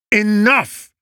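sharp, high shout of Enough!; a young man's angry shout. A narrator's reading. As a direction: slam the word down to silence the hall. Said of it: "he shouted".

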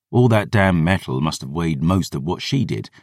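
usual speaking voice

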